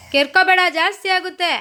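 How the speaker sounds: loud, high telling him not to scratch. Real, not acted. Mother soothing then scolding child.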